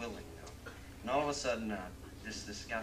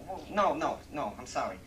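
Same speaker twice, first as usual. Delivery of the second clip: higher, louder, exasperated city guy; correcting New Yorker; 4 semitones higher, 8 dB louder than usual. Acted. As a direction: correct him, losing patience.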